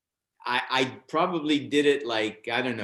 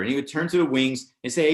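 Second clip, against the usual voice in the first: usual speaking voice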